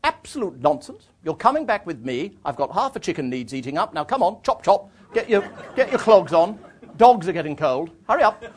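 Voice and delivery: imitated matronly chivvying, emphatic and commanding; bossy countrywoman. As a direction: take charge like a no-nonsense country lady. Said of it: "one deep breath, and said".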